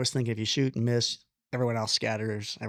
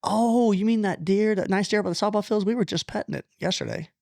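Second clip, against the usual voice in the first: a lightly put-on higher girls' voice; imitating the girls; 9 semitones higher, 3 dB louder than usual. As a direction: play the girls gushing about the nice deer. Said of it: "and they literally said".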